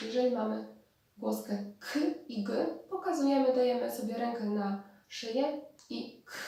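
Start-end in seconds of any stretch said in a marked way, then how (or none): 6.3-6.3 k with no vibration in the throat; voiceless k. Shown as a demonstration. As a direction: release k as a bare, voiceless burst. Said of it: "Nie drgają struny".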